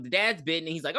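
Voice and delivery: ordinary talk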